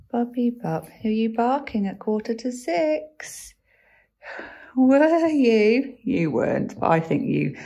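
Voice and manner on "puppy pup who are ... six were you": higher sing-song, slowing down; playful pet voice. Real, not acted. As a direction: tease the puppy fondly. Teasing the dog about barking.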